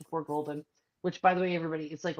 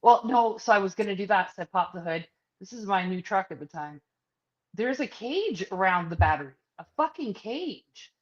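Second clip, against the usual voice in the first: ordinary talk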